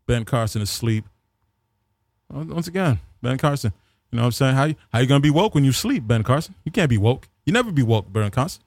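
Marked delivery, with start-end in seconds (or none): none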